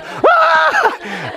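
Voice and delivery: very high comic shriek; mock startled scream. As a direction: scream like a startled group, played for laughs. Acts disciples startled by sudden appearance.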